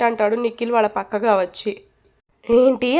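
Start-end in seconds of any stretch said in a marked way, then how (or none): 2.5-3.0 sharp, louder startled yell; a narrated girl's startled cry, only partly raised; shocked shout. A narrator's reading. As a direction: blurt it out in shock. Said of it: "అని గట్టిగా అరుస్తుంది నిక్కీ".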